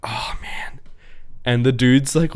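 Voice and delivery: ordinary delivery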